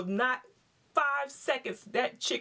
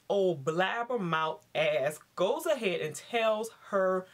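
usual speaking voice

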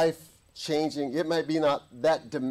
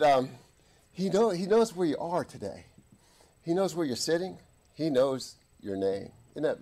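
ordinary delivery

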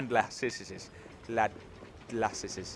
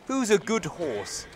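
slow, higher Queen's English coo; RP accent; 6 semitones higher, slower than usual. Shown as a demonstration. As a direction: draw it out in playful RP. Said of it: "See, that's R P".

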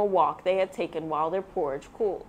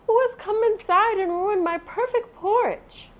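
much higher, upset Mama Bear; Mama Bear crying out; 13 semitones higher, 4 dB louder than usual. A narrator's reading. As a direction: cry out over ruined porridge. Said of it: "Mama Bear cried".

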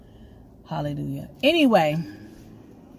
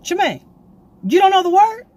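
a higher, louder mock challenge; apostle's challenge; 10 semitones higher, 6 dB louder than usual. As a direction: playfully quiz her like a stern apostle. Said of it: "He was like".

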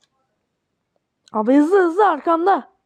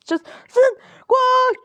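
sudden shout; 9 semitones higher, much slower than usual. Real, not acted. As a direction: yell to run. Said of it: "Bağırdım bir anda". (ani bağırış)

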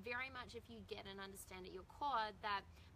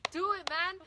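louder, higher, keen go-ahead; excited go-ahead; 8 semitones higher, 10 dB louder, slower than usual. Retold or imitated. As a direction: urge him on eagerly. Eager reply to barber.

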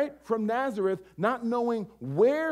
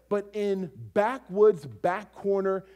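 usual speaking voice

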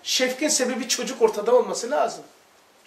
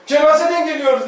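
mock-mournful wife; 6 semitones higher, 6 dB louder than usual. Wife's absurd claim, voiced.

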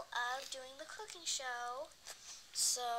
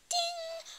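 high, loud imitated oven ding; 8 dB louder than usual. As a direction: ring out like a timer.

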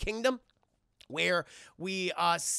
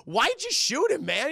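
shocked outcry; 9 semitones higher, 7 dB louder than usual. Retold or imitated. Killmonger shocked at the shooting.

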